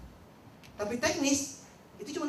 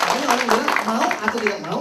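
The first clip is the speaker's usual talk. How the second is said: louder repeat; 10 dB louder than usual. Shown as a demonstration. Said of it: "Ulang ya".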